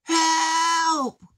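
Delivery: very high shout; cry for help. Acted. Ivan yelling for help, very high. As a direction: yell from inside. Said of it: "he heard".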